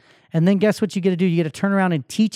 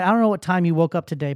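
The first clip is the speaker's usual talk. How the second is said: ordinary talk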